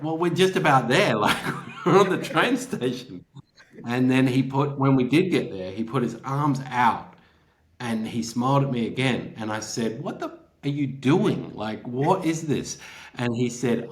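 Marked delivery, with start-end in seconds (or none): 0.0-3.2 incredulous. Retold or imitated. Baffled reply to cryptic stranger. 10.0-12.8 incredulous. Retold or imitated. Challenging stranger blocking his way.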